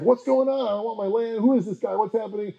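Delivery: high, mock-flustered ruler; panicked prince. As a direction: squeak out the prince's alarm. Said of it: "the Prince of Germany is like".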